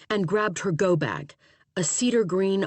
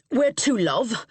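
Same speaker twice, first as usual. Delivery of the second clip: East End London cabbie; heavy Cockney cab driver; Cockney accent; 5 semitones higher, slower than usual. A narrator's reading. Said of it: "spoke with a heavy Cockney accent".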